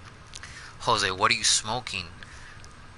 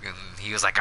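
ordinary delivery

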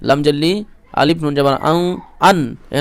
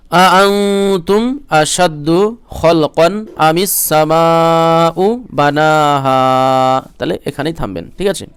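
higher, melodic drawn-out chant rising to the peak; Quran recitation; 5 semitones higher, 5 dB louder, much slower than usual. Acted. Teacher recites verse for students in tilawat style.

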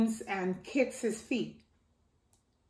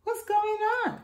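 alarmed question; 11 semitones higher than usual. Acted. Mama hears the fuss.

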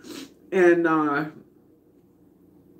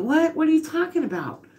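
retold disbelief; 8 semitones higher than usual. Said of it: "And I went".

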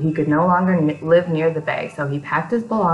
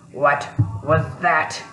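slow, drowsy grumble just woken; a lightly voiced grumpy creature waking; sleepy grumble; 2 semitones lower, slower than usual. A narrator's reading. Weedle jolted awake, grumbling at the noise. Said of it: "he grumbled".

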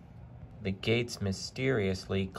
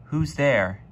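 high, louder call into the dark; nervous call; 5 semitones higher, 7 dB louder than usual. A narrator's reading. Henry calling into the misty dark, nervous. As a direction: call out uneasily, unsure who's there.

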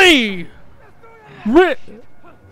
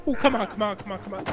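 urgent muttering; 9 semitones lower, 14 dB quieter, much faster than usual. Real, not acted. Muttering urgently during a struggle.

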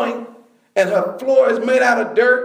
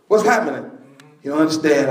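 normal talking voice

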